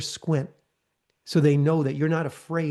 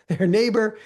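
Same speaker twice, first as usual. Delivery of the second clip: laughing; 6 semitones higher, 5 dB louder than usual. Real, not acted. Laughing aside at the neighbour.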